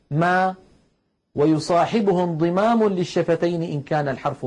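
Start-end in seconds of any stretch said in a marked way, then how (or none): 0.1-0.5 ما with the mouth wide open, drawn out slowly; demo open vowel. Shown as a demonstration. Teacher shows the open mouth on ما. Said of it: "هكذا ما".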